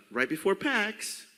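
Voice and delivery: ordinary delivery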